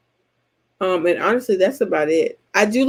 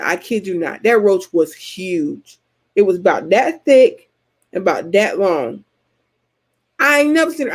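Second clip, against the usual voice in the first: normal talking voice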